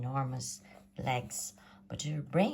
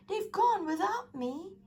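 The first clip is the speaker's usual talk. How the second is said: crying out. A narrator's reading. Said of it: "And he cried".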